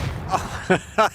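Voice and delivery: high voiceless giggle; high breathless chuckle; wheezing laugh. Real, not acted.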